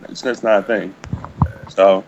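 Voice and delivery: usual speaking voice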